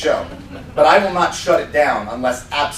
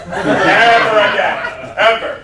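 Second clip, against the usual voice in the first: laughing; 3 semitones higher, 5 dB louder than usual. Real, not acted. Laughing repeat of "ever.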